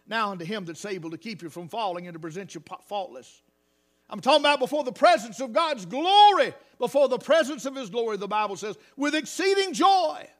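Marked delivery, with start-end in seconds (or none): 4.1-10.3 preaching. Acted. Preaching at full force.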